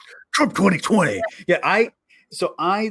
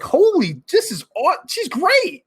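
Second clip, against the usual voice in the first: excited; 10 semitones higher, slower than usual. Retold or imitated. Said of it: "And I'm like".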